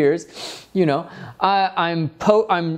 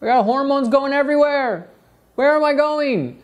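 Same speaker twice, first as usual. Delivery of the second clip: higher, flustered adolescent voice; pitched-up whiny teen voice; confused teenager; 9 semitones higher than usual. Acted. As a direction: play a lost, hormonal teen. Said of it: "It has its adolescent phase where it's like".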